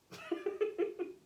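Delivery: light high-pitched laugh; soft, high giggle of disbelief. Acted. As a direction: laugh softly at your own retort. Laughs at own retort.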